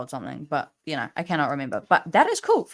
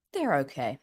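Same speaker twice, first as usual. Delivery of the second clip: sarcastic; 4 semitones lower, 6 dB quieter than usual. Acted. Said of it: "No, that was sarcasm".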